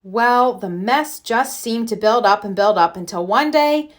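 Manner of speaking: plain reading voice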